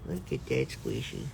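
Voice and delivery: low hushed murmur. Real, not acted. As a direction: speak softly in awe. Hushed admiring.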